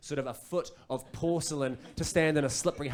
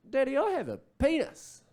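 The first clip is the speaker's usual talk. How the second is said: put-on high-pitched toddler voice; little boy; 9 semitones higher than usual. Two-year-old son to dad in the shower, high childlike announcement. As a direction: toddler announcing a discovery to daddy. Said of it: "just out of the blue, he just goes".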